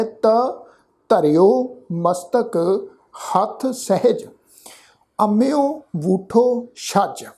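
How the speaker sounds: ਤ said short as a bare letter, then ਧਰਿਓ ਮਸਤਕ read slowly; correct pronunciation. Shown as a demonstration. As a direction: model ਤ with no vowel, slowly. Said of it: "ਮੁਕਤੇ ਰੂਪ ਵਿੱਚ ਹੀ ... ਉਚਾਰਨ ਕਰਨਾ ਹੈ".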